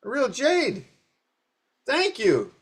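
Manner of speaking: high, loud, astonished emperor voice; suddenly gasping at the jade's worth; emperor amazed. Retold or imitated. Teacher voicing the third emperor, high and astonished. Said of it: "and the emperor says".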